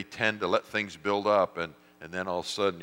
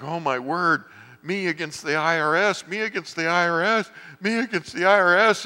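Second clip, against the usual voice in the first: rising higher and louder with each repeat, panicking; panicked inner voice; 9 semitones higher, 6 dB louder than usual. Retold or imitated. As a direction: spiral into panic over the tax letter. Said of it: "and I'm like".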